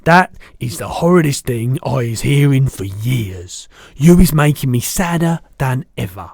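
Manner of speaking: loud, upset giant voice; kindly giant, upset. Acted. BFG horrified by Sophie's cellar story, loud distress. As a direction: protest loudly as a simple, tender-hearted giant.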